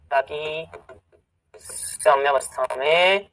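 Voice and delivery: low, drawn-out words with a pause between; slow dictation. Real, not acted. Teacher finishing the written rule for students, slow low dictation. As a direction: dictate it slowly as you write.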